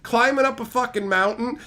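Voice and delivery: ordinary talk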